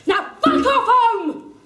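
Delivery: laughing, hammed-up shout; mock yell. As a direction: bellow it, half laughing. Thug yelling at cyclist.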